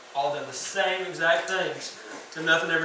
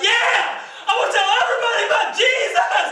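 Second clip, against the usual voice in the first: high, loud, fired-up yell; joyful mock cheer at full volume; excited shout; 8 dB louder than usual. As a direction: shout it like a kid back from camp on fire. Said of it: "you're like".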